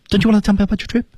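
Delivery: Don't you wanna tell me about your trip, quick and high; very fast. Shown as a demonstration. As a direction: rattle it off like flying. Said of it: "他读得比这还快".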